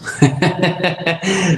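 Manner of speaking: short high laugh breaking out; risa aguda. Real, not acted. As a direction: laugh at her own story. Se ríe al contar. (risita aguda)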